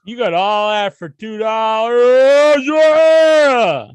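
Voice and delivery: loud, high, slow gloating shout; excited hype. Real, not acted. Hyping a cheap donation.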